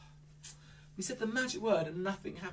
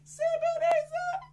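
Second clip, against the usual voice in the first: high drawn spell. Acted. Magic spell again.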